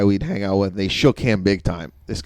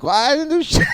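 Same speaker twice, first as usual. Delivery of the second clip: very high-pitched mock whine of protest; protesting innocence. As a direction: squeak out a helpless self-defense.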